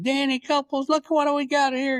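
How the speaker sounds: high-pitched imitation of an excited yell; mock yell. Retelling paramedics driving up the driveway, high mock yelling. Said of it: "started screaming and yelling".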